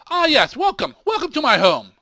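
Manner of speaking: high, loud, eager welcome; welcoming host. Acted. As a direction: greet guests like a beaming host. Host greets guests in character.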